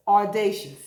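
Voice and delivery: audacious said clearly, loud, stress on DA; word said slowly together. Shown as a demonstration. Teacher leads the class saying audacious. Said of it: "Let's say the word together".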